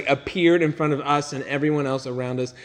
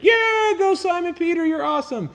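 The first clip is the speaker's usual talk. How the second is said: very high whooping cheer; mock crowd cheer; 3 dB louder than usual. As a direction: whoop like fans in the stands. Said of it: "they're all cheering".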